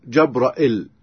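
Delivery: جبرائيل as "jabra'il", a on the jim and a hamza; "jab-ra-'il" for جبرائيل; Shu'ba's reading. Shown as a demonstration. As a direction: glottal catch before "il" in جبرائيل. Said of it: "في رواية شعبة يقول".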